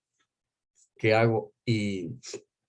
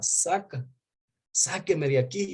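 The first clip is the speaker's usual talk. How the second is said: imitating the wife's plea, higher; wife pleading; 7 semitones higher than usual. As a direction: mimic her begging to be taken out, pitched up. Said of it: "mi esposa me suplicaba". (imitando la súplica de la esposa, más agudo)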